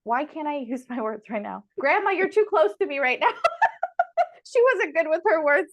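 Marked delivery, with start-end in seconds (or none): 1.8-5.7 fast, higher, laughing plea. Real, not acted. Telling her grandmother's spirit to back off, laughing. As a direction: laugh it out, a bit louder.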